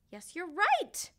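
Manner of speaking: ordinary delivery, nothing marked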